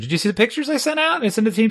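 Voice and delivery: high bright repeated question; lightly mimicking polite small talk; chirpy high question. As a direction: ask it in a sing-song high voice. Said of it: "the same question".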